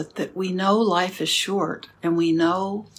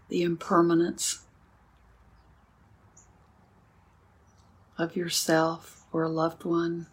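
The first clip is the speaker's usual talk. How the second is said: guided meditation; 4 dB quieter than usual. Acted. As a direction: guide the sitting gently, with long pauses. Leads a sitting meditation.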